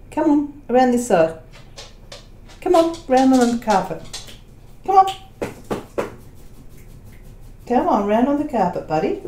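high, sing-song calling to a dog; coaxing pet talk. Real, not acted. Calling her dog Bo onto the carpet, coaxing pet voice. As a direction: coax the dog over, light and high.

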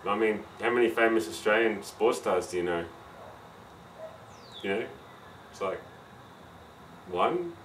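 relaxed Aussie accent, low; broad Australian voice. Real, not acted. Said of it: "last time you were gonna hear my Australian accent".

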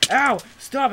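high, loud yelp; protesting yell. Real, not acted. Player attacked while just sitting, protesting yelp. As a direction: cry out in protest at being hit. Being hit by friend in game.

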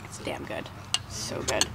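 whispered praise; whispering. Real, not acted. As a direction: breathe it out quietly. Tasting soup, hushed praise.

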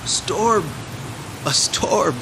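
high-pitched cry of the peasant. A narrator's reading. Peasant seeing the dark sky before rain, crying out. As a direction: cry it out high, without added volume. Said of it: "cried the peasant".

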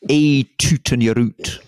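a Scottish minister trumpeting the punchline. Acted. Telling father's music hall joke as minister, mock Scottish accent. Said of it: "the minister's supposed to say".

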